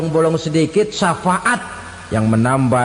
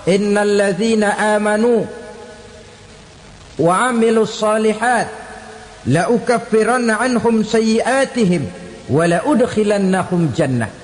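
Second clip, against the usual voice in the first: raised, sustained reciting tone; declaimed scripture, higher than talk; Quran recitation; 4 semitones higher than usual. Acted. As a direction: recite the verse in measured Arabic phrases. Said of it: "Apa kata Allah?".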